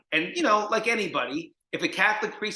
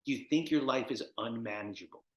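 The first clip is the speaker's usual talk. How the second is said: lower, softer measured question; quiet probing counselor; 7 semitones lower, 8 dB quieter than usual. Retold or imitated. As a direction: imitate a counselor asking gravely.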